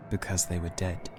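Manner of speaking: ordinary delivery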